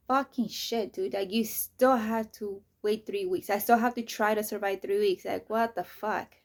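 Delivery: loud, stretched-out frustrated rant; loud disbelieving rant at the hospital's wait; exasperated outburst. Retold or imitated. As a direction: blow up at the news like you can't take it. Retelling dismay at three-week wait.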